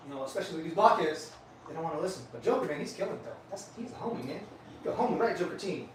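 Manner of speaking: ordinary talk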